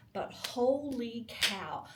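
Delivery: usual speaking voice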